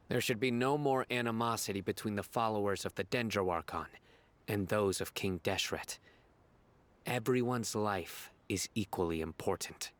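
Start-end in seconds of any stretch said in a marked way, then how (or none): none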